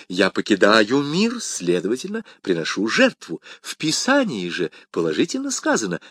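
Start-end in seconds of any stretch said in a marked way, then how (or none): none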